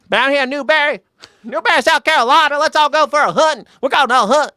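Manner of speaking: South Carolina accent, exaggerated; Southern accent. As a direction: imitate a South Carolina buddy, hammed up. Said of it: "He always talks so funny".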